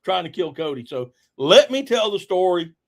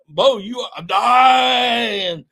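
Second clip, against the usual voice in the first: slow, drawn-out, higher half-joking cry; mock alarmed shout; 7 semitones higher, 6 dB louder, much slower than usual. Narrator reacting to his friend's retching, slow mock shout. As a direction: act out your own horrified thought.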